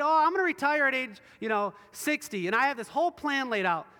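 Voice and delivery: loud, high, confident boast; boastful. Acted. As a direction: brag about your plans with full certainty. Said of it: "that's boastful and evil".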